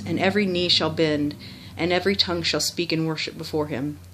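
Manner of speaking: ordinary talk, nothing marked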